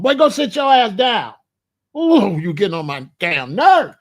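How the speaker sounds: loud, exasperated mom impression; mama at home. Imitating mama ordering her boy around at home, loud scolding. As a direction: scold the boy like a fed-up mother. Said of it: "I just know my mom at home".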